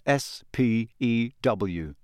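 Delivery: S P E W said as four separate letters, slowly; spelled out letters. A narrator's reading. Narrator reading the badge letters, slowly spelled.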